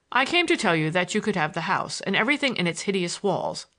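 ordinary delivery

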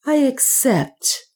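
a hint of a serene young priest; clear priest's acceptance. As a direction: accept calmly like a resolved priest. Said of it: "in a clear voice".

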